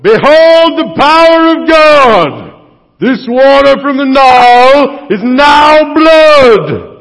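hammed-up booming declaration of God's power; grand proclamation. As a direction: proclaim it like a showman before the elders. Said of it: "and you go".

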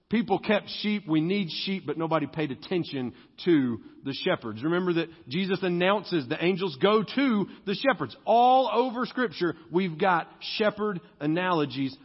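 ordinary delivery

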